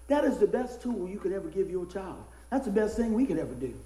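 usual speaking voice

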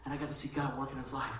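normal talking voice

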